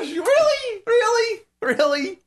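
high-pitched repeated disbelief; exaggerated incredulity; incredulous. Real, not acted. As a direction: question the lame excuse, each time coming down in pitch. Disbelief at his excuse.

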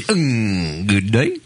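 lightly voiced old radio sign-off; a hint of the old radio host; Paul Harvey impression. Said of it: "You don't know Paul Harvey?".

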